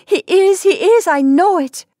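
very high, loud, desperate urging; urgent insistence. A narrator's reading. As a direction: insist like a frantic mother. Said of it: "urged the mother".

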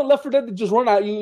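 normal talking voice

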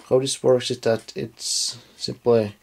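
usual speaking voice

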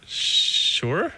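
drawn-out, rising, unsure reply; hesitant agreement. Retold or imitated. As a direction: stretch the sh, then rise uncertainly. Hesitant answer to daunting request.

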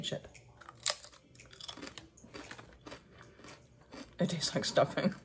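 talking with food in mouth; speaking between chews; chewing while talking. Real, not acted. Seen 0: Bites chip at -4; closed-mouth chewing visible through about 2.0s.

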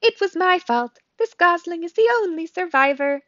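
high, incongruously cheerful; chirpy robot voice. A narrator's reading. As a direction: announce it brightly as a friendly robot. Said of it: "does your voice sound so cheerful".

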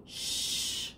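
soft unvoiced shh; hushing. A narrator's reading. Aunt hushing the child by the bird tree, whispered. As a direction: hush the child gently. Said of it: "she says".

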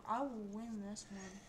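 ordinary talk, nothing marked

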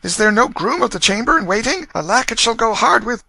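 loud, high royal summons; calling out. A narrator's reading. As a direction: call for your servants loudly. Prince calls for servants.

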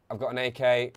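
ordinary talk

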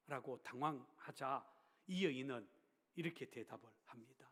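ordinary talk

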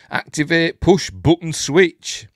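exaggerated announcer delivery; instruction read-out. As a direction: hammer out each word of the instruction. Declaims odd on-screen instructions.

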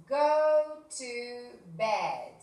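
very slow, stretched phrase; slow drawn-out model. Shown as a demonstration. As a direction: draw every word out for them. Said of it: "teacher models verb phrase". (muy lento y alargado)

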